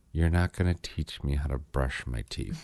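low, soft, scornful inner voice; imitated silent disdain, dropped low and quiet; inner eye roll. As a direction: think it with a major eye roll. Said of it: "I did a major eye roll".

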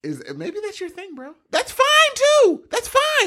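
a high, mock-soothing tease; high mock reassurance. Real, not acted. Teasing friend in high voice.

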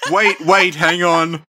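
loud, high imitating wait; hammed-up mock interruption. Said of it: "Ninety-five percent of comedy is timing".

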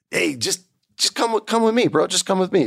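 high, thin coaxing voice; helpless coaxing. Acted. Newcomer leading the giant away, helplessly coaxing. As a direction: coax him along in a squeaky voice.

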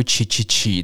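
"起起起" as repeated crisp "ch-ee" syllables; Italian "ch" sound. Shown as a demonstration. Teacher showing listeners how Italian CI is read, "起起起" as chee. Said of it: "就可以念出起起起这个声音".

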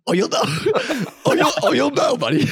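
high, loud knowing teasing voice; ominous tease. Retold or imitated. Dalton warning him about the booster, high teasing voice. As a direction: promise trouble with a grin. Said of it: "He's like".

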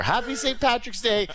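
mock cheerful mother; mocking mom's text. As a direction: read mom's text in a chirpy put-on voice. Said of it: "woke up to a text message from her".

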